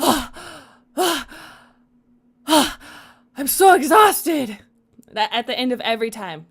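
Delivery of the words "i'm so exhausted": put-on exhausted boyfriend. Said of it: "he's just like".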